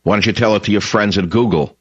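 usual speaking voice

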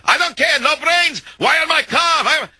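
very high-pitched ranting of an enraged Russian; mock Russian screaming. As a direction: imitate a livid Russian shrieking in broken English, without extra volume. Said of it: "he's screaming and yelling".

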